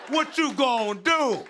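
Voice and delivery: drawn-out, high taunt; defiant challenge. Retold or imitated. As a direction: dare the heckler to act. Acted comeback to the heckler.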